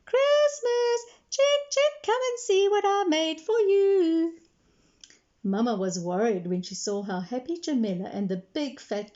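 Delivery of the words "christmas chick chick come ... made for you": high, loud singsong call. A narrator's reading. Jamila runs outside calling her chicken, calling out. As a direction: call the chick from across the yard.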